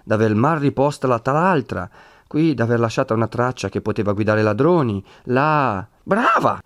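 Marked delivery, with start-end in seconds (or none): 6.0-6.6 scornful high «Brava!»; mock praise, scolding; sarcastic Brava. A narrator's reading. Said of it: "disse Don Abbondio". («Brava!» detto con sarcasmo, acuto)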